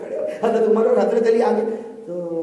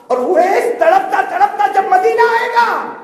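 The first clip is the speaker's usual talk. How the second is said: anguished cry; 13 semitones higher, 10 dB louder than usual. Acted. Preacher dramatizing Uwais's anguish.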